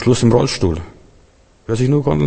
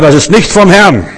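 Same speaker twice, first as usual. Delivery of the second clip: stern rebuke; 7 semitones higher, 6 dB louder than usual. Retold or imitated. Rebuking a sister in church.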